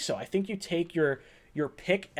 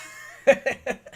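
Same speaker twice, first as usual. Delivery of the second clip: breathy unvoiced laugh, higher; burst of laughter; 7 semitones higher than usual. Real, not acted. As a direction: crack up at the joke. Laughing at funny super chat.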